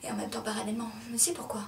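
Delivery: soft, half-whispered restart; very quiet murmur; hushed. Acted. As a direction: pick it up under your breath. Drops to hush after outburst.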